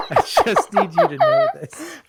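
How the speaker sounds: high-pitched words through real laughter; real laughter breaking the words; laughing. Real, not acted. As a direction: talk while cracking up. Both laughing hard.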